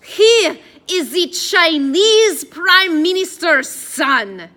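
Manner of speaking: put-on exasperated, very loud French accent; slow, high, hammy French insistence; exaggerated French accent. A narrator's reading. Addison correcting the doubting guard, exasperated French accent. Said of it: "speaking in an exaggerated French accent".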